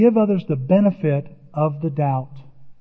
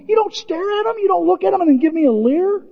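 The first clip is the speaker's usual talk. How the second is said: much higher, playful mock of a stare; mock leer; 13 semitones higher than usual. Real, not acted. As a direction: tease the idea of a leer. Mimics a leering stare.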